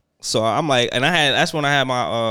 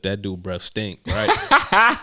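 ordinary delivery

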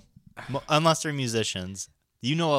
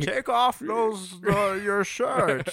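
slow, muffled villain seduction; Bane voice; 9 semitones higher, much slower than usual. Pretend. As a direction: hands over face, purr it like Bane. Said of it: "to do the Bane".

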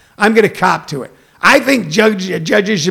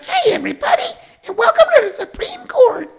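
very high, squeaky cartoon host; faster than usual. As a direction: squeak out a show welcome. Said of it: "Warner Brothers cartoon voice".

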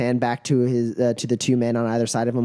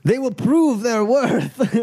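raised, measured proclamation; ceremonial fight master; 11 semitones higher, 4 dB louder than usual. Acted. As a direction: declaim it grandly to the crowd. Game master plays Boris proclaiming.

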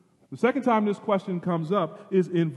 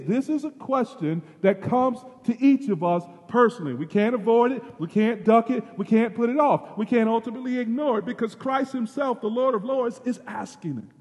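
louder and higher, building fervor; preaching at full force; 7 semitones higher, 4 dB louder than usual. Real, not acted. Preacher insisting nobody can avoid the question. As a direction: hammer each point home.

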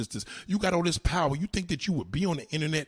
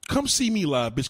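ordinary talk

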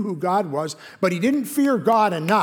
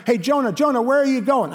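higher-pitched teasing friend voice; mock friend calling; 8 semitones higher, 5 dB louder than usual. A friend hails Jonah on his way, higher mock call. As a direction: play the nosy friend calling after Jonah.

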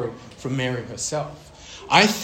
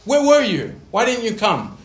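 much higher, louder frantic scolding; furious parents; 9 semitones higher, 6 dB louder than usual. Retold or imitated. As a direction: demand answers from a missing son.

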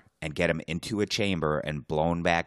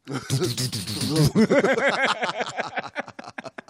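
high laugh; 14 semitones higher, 5 dB louder than usual. Real, not acted. Laughing at the sub footage.

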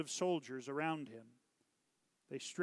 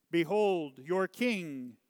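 loud opening word, slightly raised; proclamation; 3 semitones higher, 6 dB louder, slower than usual. A narrator's reading. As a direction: announce him to the crowd. Pilate presents king to crowd.

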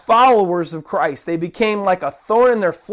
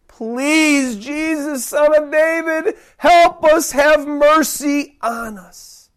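higher, drawn-out plea; desperate crying out; 5 semitones higher, slower than usual. Acted. Blind men begging Jesus for healing, desperate cry. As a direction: beg for mercy, slowing to the end. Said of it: "why they cried out the way".

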